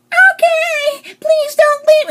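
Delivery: very high, loud squeaky plea; overacted high-pitched begging; mock Sophia pleading. Sophia pleading with Rick, in a loud mock squeal.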